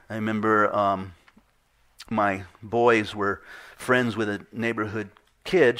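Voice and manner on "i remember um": ordinary delivery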